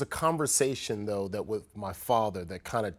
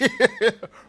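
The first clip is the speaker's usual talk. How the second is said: loud laugh; 10 dB louder than usual. Real, not acted. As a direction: burst of high laughter. Laughing at syringe joke.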